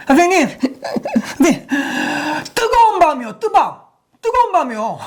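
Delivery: put-on high, giggling girl's answer; laughing schoolgirl. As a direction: blurt it out through laughter. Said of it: "이렇게 하는 거예요". (웃음 섞인 높은 여학생 목소리)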